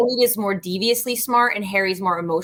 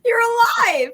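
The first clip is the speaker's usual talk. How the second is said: quoted movie yell; 12 semitones higher than usual. Retold or imitated. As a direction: shout the line at your brother like the movie. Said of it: "and I'm like".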